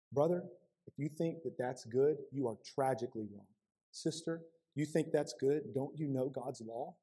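soft, hushed brotherly correction; gentle rebuke. Shown as a demonstration. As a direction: say it softly, as to a friend in the pew. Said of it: "And we talk like that to each other".

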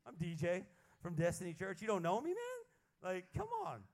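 pleading hyped-up. Pretend. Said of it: "call John Maxwell and be like".

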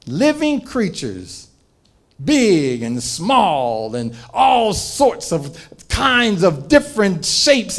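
usual speaking voice

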